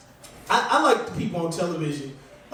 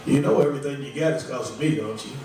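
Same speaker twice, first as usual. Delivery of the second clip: mock God voice; 7 semitones lower than usual. God texting the comic.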